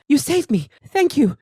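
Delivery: high-pitched damsel thanking her rescuer; grateful rescued woman. Acted. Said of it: "the woman who was attacked looks up at her and goes".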